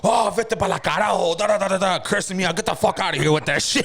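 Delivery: high-pitched mock rant; angry dad. Dad imitated cursing out his crying son, mock fury. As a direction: rattle off a parent's cursing, breaking into a laugh. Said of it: "he got so fucking mad".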